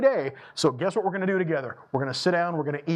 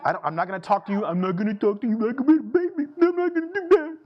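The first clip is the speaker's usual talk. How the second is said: high, put-on babyish voice; hammed-up baby voice; mock baby talk voice; 5 semitones higher, faster than usual. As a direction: parody talking down to a toddler. Mocking baby talk to kids.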